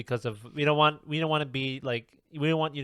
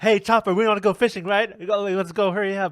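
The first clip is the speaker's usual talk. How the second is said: much higher, louder, eager coaxing; fake casual bait; 8 semitones higher, 6 dB louder than usual. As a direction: lure them back with forced cheer. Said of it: "throwing out all the tactics".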